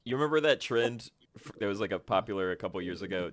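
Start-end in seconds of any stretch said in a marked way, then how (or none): none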